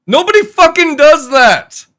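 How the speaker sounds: high, loud shout of frustration; furious shout. Real, not acted. Ranting at the game developers, furious shout. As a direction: yell it out, fed up.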